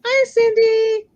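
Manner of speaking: high, slow sing-song hello; high sing-song greeting to a friend. Real, not acted.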